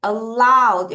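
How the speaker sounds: "Allowed" with LOWED hit loud; weak first vowel, strong falling LOWED in "Allowed"; stress demonstration. Shown as a demonstration. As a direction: show learners where "Allowed" is stressed, on LOWED. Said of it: "여기서 강세다".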